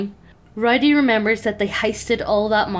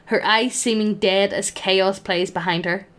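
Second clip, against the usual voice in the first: ordinary talk, nothing marked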